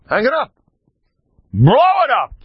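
high, loud exhorting call; excited urging. Acted. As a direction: push them to hang it big. Urging listeners to display picture.

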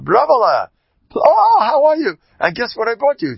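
high, loud, delighted reunion cry; excited sister greeting. Retold or imitated. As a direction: play the overjoyed sister bursting in. Sister greets Rava after years.

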